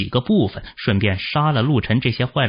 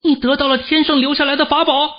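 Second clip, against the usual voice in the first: very high-pitched youthful cry; startled, high, sustained exclamation; loud exclamation; faster than usual. Acted. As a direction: call out a sudden realization in a high voice. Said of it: "大声道".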